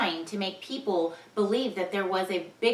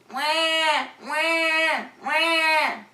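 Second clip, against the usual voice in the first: mock crying; 7 semitones higher, much slower than usual. Ben crying for attention.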